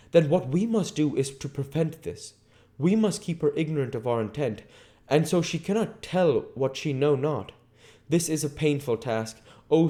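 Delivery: Van Helsing voice. A narrator's reading.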